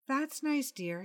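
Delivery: breezy, high, not listening; absent-minded wife. Acted. Portia half-hearing her bleeding husband, breezy and absent. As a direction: brush him off sweetly.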